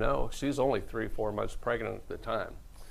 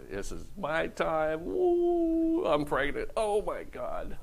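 mock shocked reaction; 5 semitones higher, slower than usual. Joseph reacting to pregnancy.

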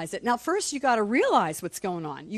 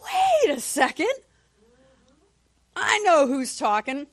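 high, drawn-out mock alarm; hammed-up high-pitched halt; sudden realisation; 5 semitones higher, 4 dB louder, slower than usual. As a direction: stretch it out high as it dawns on you. Acts out catching the devil.

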